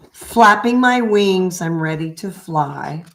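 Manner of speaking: gentle sing-song picture book read; reading to children. Acted. Reads picture book aloud.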